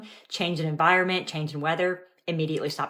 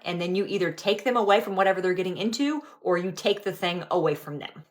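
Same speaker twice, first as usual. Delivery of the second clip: ordinary talk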